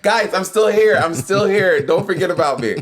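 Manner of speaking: high, playful calling out; mock plea to viewers. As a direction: call to the fans for attention, jokingly. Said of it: "I'm like".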